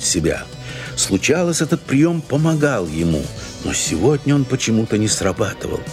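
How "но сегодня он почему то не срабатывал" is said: ordinary delivery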